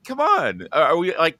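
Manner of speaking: usual speaking voice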